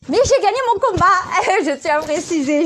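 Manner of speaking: high laughing boast; laughing proudly. Real, not acted. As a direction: laugh through a proud brag.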